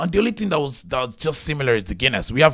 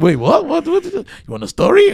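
baffled; 10 dB louder than usual. Retold or imitated. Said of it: "I was like".

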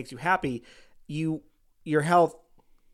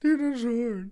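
higher, put-on silly voice; mock imitation, pitched up; echoed mocking voice; 6 semitones higher, slower than usual. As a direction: parrot the teasing impression back. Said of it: "Is that what I sound like".

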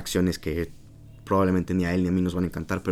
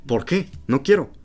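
acted indignant patient, high and louder; mock refusal, pitched up in disbelief; paciente indignado; 8 semitones higher, 4 dB louder, slower than usual. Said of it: "Me va a escupir en la cara". (imitando una negativa indignada, aguda)

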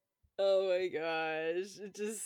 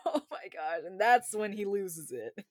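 ordinary talk, nothing marked

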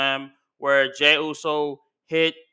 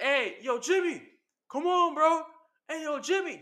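high, raised call across the ring; 9 semitones higher than usual. Retold or imitated. Jey calling his brother over, high mock friendly call. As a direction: call out to him, pitched up high.

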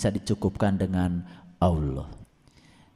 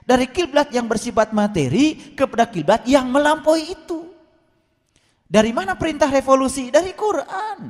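high-pitched, insistent preaching; impassioned preaching; 13 semitones higher than usual. Real, not acted. Preacher explaining the holy place to congregation, impassioned.

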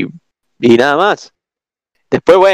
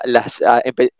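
ordinary talk, nothing marked

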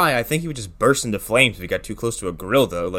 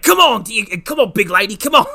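higher mock animal-wrangler shout; Steve Irwin; 7 semitones higher, 3 dB louder than usual. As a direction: urge the beast on like an Australian wrangler. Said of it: "be like".

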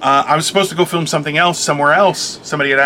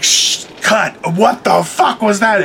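stunned director; 5 semitones higher, slower than usual. Retold or imitated. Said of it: "They were like".

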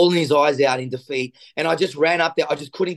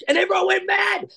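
excited shout; 14 semitones higher than usual. Real, not acted. Telling the host how the crowd erupted, excited shout. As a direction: blurt it out thrilled.

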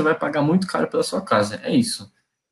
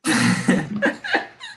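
high laugh. Real, not acted. Laughs at a joke.

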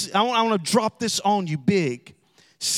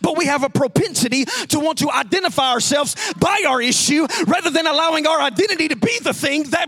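raised, ringing pulpit voice; preaching at full force; 6 semitones higher, 4 dB louder, faster than usual. Acted. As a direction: drive the point home to the congregation. Preaching climax.

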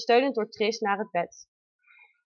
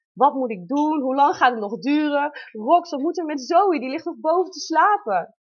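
higher, louder flustered questions; panicked questions; 5 semitones higher, 5 dB louder than usual. Retold or imitated. Startled father asking what to do, mildly panicked. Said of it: "schoot in de stress".

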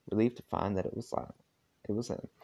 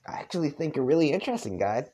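fast, higher southern reading; a light southern accent; 6 semitones higher, faster than usual. Acted. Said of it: "I'm trying to do a southern accent".

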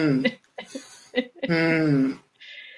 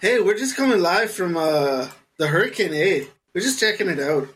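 put-on Canadian accent. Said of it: "the whitest accent fucking imaginable".

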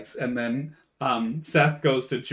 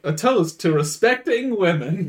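slow, raised, mock-ceremonious proclamation; grand toast; 7 semitones higher, 5 dB louder, slower than usual. Character toasting respectfully to women, grandly proclaiming. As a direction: draw it out like a drunken toast.

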